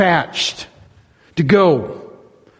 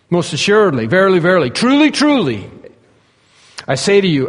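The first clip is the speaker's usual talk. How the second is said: raised, emphatic scripture quote; 4 semitones higher, 4 dB louder than usual. Acted. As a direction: drive each solemn phrase home, pitch climbing on the repeats. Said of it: "when he speaks it very emphatically".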